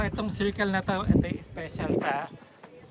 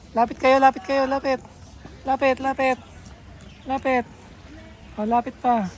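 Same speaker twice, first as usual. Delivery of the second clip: calling out; 7 semitones higher, 5 dB louder than usual. Real, not acted. Calling group to come closer.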